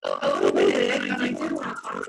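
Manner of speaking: choppy, very low glitchy call audio; garbled robotic voice over a bad connection. Real, not acted. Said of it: "you sound like a robot".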